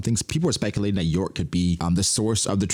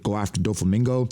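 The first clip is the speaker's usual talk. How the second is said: ordinary talk, nothing marked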